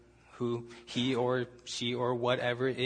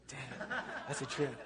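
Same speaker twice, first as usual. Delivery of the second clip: echoey, robotic room sound; 9 dB quieter than usual. Real, not acted. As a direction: voice bouncing back like a robot. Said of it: "I sound like a robot".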